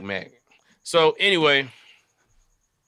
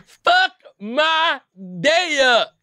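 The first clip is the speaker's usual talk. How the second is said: high squeaky repeat of the dare; even higher squeaky challenge; squeaky hype again; 3 dB louder, much slower than usual. Real, not acted. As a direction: squeal the dare once more. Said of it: "don't think I heard anybody ever say some shit like that".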